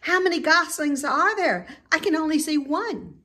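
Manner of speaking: put-on young pig eagerly counting goslings; Wilbur. A narrator's reading. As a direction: ask it with excited curiosity. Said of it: "shouted Wilbur".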